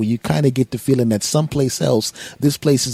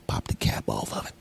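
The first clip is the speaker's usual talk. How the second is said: very low, whispered tease; hushed mock come-on; mock-seductive whisper; 11 dB quieter than usual. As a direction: whisper it suggestively. Sultry alter-ego talk continues.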